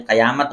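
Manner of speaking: قیامت as "qayāmat", short "a" after qaf; mispronounced with zabar. Shown as a demonstration. Teacher flags the wrong reading, قیامت said "qa-yāmat". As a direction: say قیامت with the faulty "qa" opening. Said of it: "غلط تلفظ ہے".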